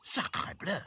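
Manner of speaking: high French exclamation; French-accented protest. A narrator's reading. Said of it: "protested Claude".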